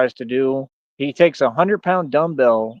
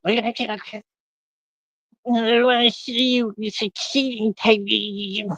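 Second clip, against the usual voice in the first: high, slow, whiny mock voice of a hater; sneering high-pitched parody of a critic; 9 semitones higher, slower than usual. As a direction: parody them in a silly high drawn-out voice. Mocking a hater's voice.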